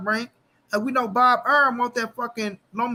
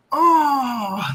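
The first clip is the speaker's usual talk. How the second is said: loud, high mock crowd gasp; stadium gasp; 5 semitones higher, 5 dB louder than usual. Imitating the stadium crowd reacting to the punch, loud gasp. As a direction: imitate a whole stadium gasping. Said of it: "the whole motherfucking stadium said".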